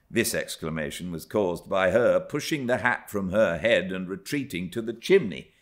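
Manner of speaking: ordinary delivery, nothing marked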